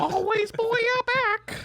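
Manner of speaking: very high, slow squeaky squid voice; high gargling cartoon squid; gurgly squid voice. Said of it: "let's all talk like this".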